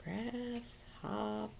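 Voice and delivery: Grasshopper drawn out slowly, syllable by syllable; slow, stretched out. Shown as a demonstration. Teacher to pupils, stretching the word out slowly. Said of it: "teacher repeats word for syllables".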